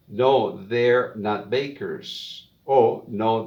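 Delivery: slow, clear model reading; slow practice reading. Shown as a demonstration. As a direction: read each line slowly for students to repeat. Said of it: "solo voy a leer".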